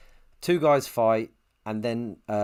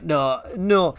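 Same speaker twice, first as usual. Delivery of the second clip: put-on higher, tentative Welsh accent; fumbled go at a Welsh voice; attempted Welsh accent; 5 semitones higher than usual. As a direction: try out a Welsh accent on the reply. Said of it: "Hang on, Welsh".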